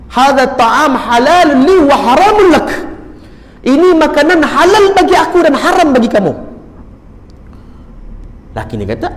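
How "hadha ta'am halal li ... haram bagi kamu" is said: loud, high declamation, drawn out; acted ringing pronouncement; retold forceful declaration. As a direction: proclaim the refusal like a solemn ruling. Neighbour refuses food in solemn declaration.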